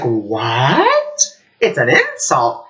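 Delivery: drawn-out, incredulous "what"; mildly acted-out astonishment; mock disbelief. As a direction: re-enact being taken aback. Retells shock at friend's revelation.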